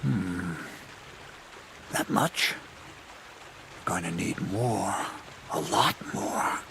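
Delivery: soft, absorbed muttering; lost in thought. Acted. Schemer working out what he needs, lost in thought. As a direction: think aloud under the breath, in fragments. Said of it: "I got lost in thought".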